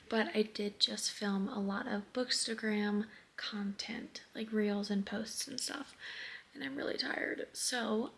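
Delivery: soft unvoiced whisper, barely there. Real, not acted. Vlogger whispering a late check-in to viewers. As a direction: hush it so no one wakes.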